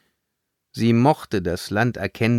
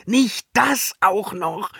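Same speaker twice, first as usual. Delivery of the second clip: high, drawn-out exasperated groan; pained, theatrical dismay; 14 semitones higher, 4 dB louder than usual. A narrator's reading. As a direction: moan as if this is the last straw. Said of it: "stöhnte Sanja".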